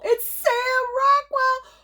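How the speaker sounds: very high, slow, drawn-out shriek; high excited squeal. Real, not acted. Announcing her favorite actor to viewers, squealing. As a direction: squeal the name like a thrilled fan.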